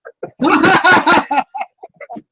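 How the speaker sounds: high, loud burst of laughter; hearty laugh. Real, not acted. Co-host cracking up at a joke told in German, hearty high laugh.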